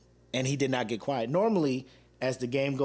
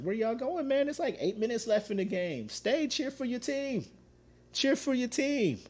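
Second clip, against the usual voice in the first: mocking the leaving fans; 11 semitones higher than usual. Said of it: "I did videos like".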